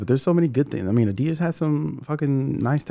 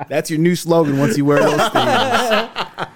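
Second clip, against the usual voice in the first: usual speaking voice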